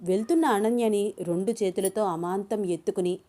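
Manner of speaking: usual speaking voice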